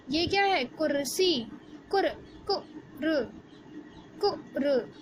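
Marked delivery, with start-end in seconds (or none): 1.9-4.9 कुर said with a short light उ; short u in kur. Shown as a demonstration. Teacher to class, showing the short उ in कुर्सी. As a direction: clip कुर short and easy, no stretching the उ. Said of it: "मुझे ज़्यादा ज़ोर तो नहीं लगाना पड़ रहा".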